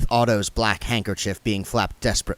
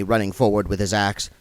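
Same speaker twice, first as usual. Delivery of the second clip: ordinary delivery, nothing marked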